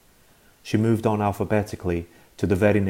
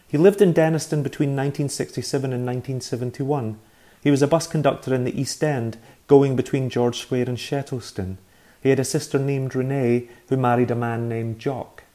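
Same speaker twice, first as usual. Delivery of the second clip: higher, careful recitation of details; boy reciting politely; 5 semitones higher, 4 dB louder than usual. Acted. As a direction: rattle it off like a dutiful child, a little higher.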